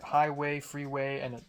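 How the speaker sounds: ordinary delivery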